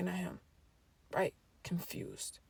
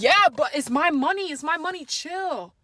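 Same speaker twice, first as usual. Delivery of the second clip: brother imitated higher and louder; defensive brother, pitched up; little brother's voice; 6 semitones higher, 6 dB louder than usual. Brother defending his spending, high whiny voice. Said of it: "He's like".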